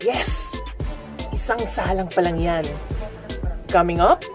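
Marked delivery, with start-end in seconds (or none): none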